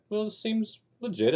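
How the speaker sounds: put-on high, eager boy's voice; naive young son. Young son answering his father's test question, high and naive. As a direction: play the trusting kid who buys it.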